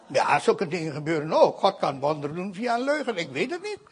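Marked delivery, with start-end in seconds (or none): none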